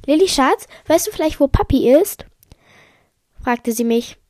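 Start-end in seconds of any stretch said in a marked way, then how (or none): 0.1-2.3 lightly voiced mother, higher and louder; mother calling. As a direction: ask the child in a raised motherly voice. Mother asking, voiced in story.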